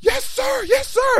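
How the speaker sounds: very high, shouted eager compliance; panicked yes sir. Retold or imitated. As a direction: snap to attention in panic. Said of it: "And he's like".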